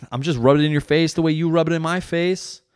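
high, indignant mock retort; defensive comeback. Husband answering back in a quarrel, defensive.

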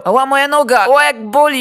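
high, loud mock cries of pain; hurt cyclist. Cyclist who crashed off a wall, loud mock wailing in pain. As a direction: ham up a fall off a bike. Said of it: "Powiedział".

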